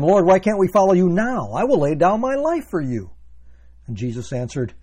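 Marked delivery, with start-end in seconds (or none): 0.0-3.1 loud, higher, insistent pledge; Peter's bravado. Acted. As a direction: protest boldly with raised voice. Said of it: "Thus his bravado".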